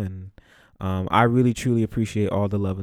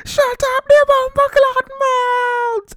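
put-on much higher and louder West Indian accent, slow and furious; hammed-up West Indian shout, much higher and louder; imitated West Indian yell; 8 dB louder, slower than usual. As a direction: yell as the enraged West Indian gym teacher. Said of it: "My West Indian accent is very bad".

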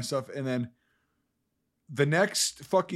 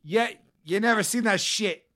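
put-on shaky grip on a Boston accent, climbing higher; failed Boston accent; 5 semitones higher than usual. Copying a friend's movie line, failing Boston accent. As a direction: try Boston and lose hold of it. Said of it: "I can't do a fucking Boston accent".

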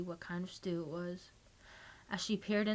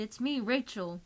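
pleading; 4 semitones higher, 4 dB louder than usual. A narrator's reading. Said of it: "she pleaded".

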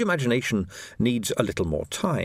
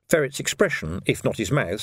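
ordinary delivery

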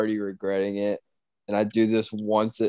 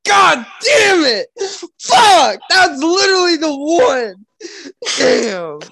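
much higher, louder cry of frustrated despair; anguished outburst; 10 dB louder, slower than usual. Real, not acted. Friend losing his movie pick to Anchorman, anguished shout. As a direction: yell it like your last pick just got stolen. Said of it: "that one had you heated".

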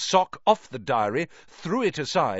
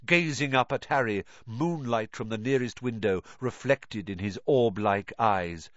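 ordinary delivery, nothing marked

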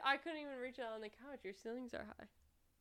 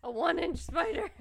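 teasing; 8 semitones higher, 11 dB louder than usual. Pretend. Teasing mockery.

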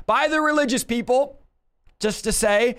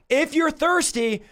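higher, slow calling out; loud cry; 6 semitones higher, slower than usual. Acted. Preacher voicing Jesus calling the thirsty people, loud slow cry. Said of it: "Jesus is crying out in a loud voice".

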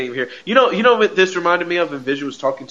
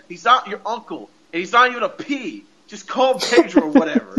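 high-pitched, climbing annoyance; mock exasperation; 7 semitones higher than usual. Fed-up viewer setting the kids straight about Uncle P, mock exasperation. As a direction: correct them, getting worked up and higher. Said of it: "I'm getting mad at these kids".

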